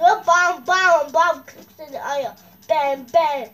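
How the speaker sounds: loud punchy shouts; shouting. Real, not acted. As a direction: bark each hit loudly. Shouting hits in game fight. (laute Rufe)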